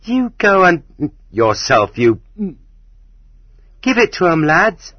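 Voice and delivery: high-pitched, scornful retort; defiant girl egging the lads on. A narrator's reading. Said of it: "She stared scornfully".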